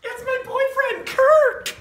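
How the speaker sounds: high, loud giddy squeal; gushing squeal. Acted. Teen girl announcing her boyfriend, squealing with delight. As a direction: swoon over the name.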